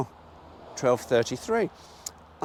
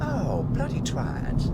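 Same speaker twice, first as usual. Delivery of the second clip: annoyed curse; 10 semitones higher, much slower than usual. Real, not acted. Annoyed at missing turn.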